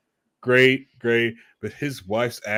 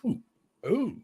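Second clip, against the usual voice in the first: higher, mock-swooning ooh and mm; 10 semitones higher than usual. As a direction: melt over her voice, teasing. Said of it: "I was like".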